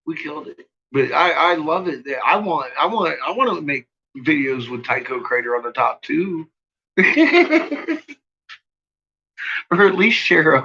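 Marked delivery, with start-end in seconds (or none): 0.1-0.7 much softer "We killed it", to himself; quiet. Real, not acted. Repeating the win to himself. 7.0-8.1 a high, loud burst of giggling; high giggle. Real, not acted. Laughing at his own plan.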